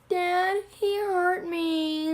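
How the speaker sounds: put-on slow, high, drawn-out child-like complaint; hammed-up wail to a parent; whiny tattling. Boopkins tells his octopus dad, slow high whine.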